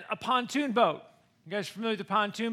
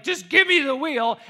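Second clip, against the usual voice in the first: louder, higher dad demanding control; retold yelling; 4 semitones higher, 7 dB louder than usual. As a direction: imitate a dad yelling to take over. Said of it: "Everybody's yelling".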